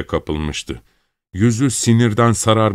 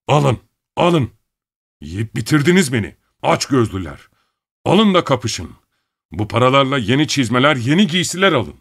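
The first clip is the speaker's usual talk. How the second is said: higher, raised angry voice; enraged outburst; 6 semitones higher, 3 dB louder than usual. A narrator's reading. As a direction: hurl it at the family in a fury. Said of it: "Bedeni sinirden titriyordu".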